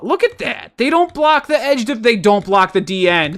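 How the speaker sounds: high-pitched, incredulous complaint; worked-up, pleading frustration; exasperated disbelief. Real, not acted. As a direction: protest it with your voice shooting up. Exasperated at the missed block.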